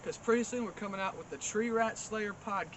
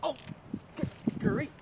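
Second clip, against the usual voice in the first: "good grief" squeaked out much higher, a pained yelp; startled; 8 semitones higher than usual. Real, not acted. Nunchuck mishap.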